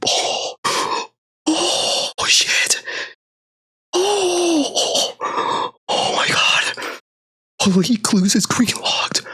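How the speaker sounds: stunned, gasping whisper; freaking out quietly. Real, not acted. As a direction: breathe out your disbelief without waking anyone. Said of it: "I'm freaking out".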